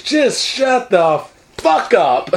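high, drawn-out exasperated outburst; panicked snap. Acted. Panicking son silences his mother, high and exasperated. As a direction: stretch each word in mock desperation. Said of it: "He's panicking".

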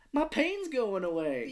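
put-on high, slow childlike voice; childlike dying man. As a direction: imitate a simple, childlike man, drawn out. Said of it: "he has the mind of a child".

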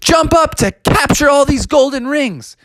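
high, eager cartoon hero; excited high-voiced game guide; Sonic. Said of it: "And Sonic was like".

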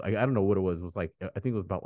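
usual speaking voice

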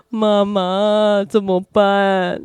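slow, drawn-out imitation of a helpless child; helpless little child. Child climbs onto crying mother, imitated helpless, slow child voice. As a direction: imitate a little child pleading, stretching the words. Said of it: "又趴到我身上说".

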